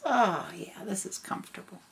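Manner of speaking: contented breathy whisper. Acted. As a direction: sigh it out in a whisper. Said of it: "She says".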